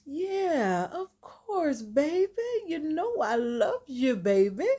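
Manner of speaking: high, drawn-out crooning voice; exaggerated syrupy flirting; sweet-talking man. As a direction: lay on the mock affection. Acts out Dennis sweet-talking Angela.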